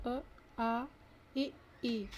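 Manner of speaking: अ, आ, इ, ई separately, lips unrounded; short then long in each pair, lips not rounded; unrounded vowels. Shown as a demonstration. Teacher showing vowels without a rounded mouth, unrounded. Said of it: "वृत्तमुखी नहीं होता".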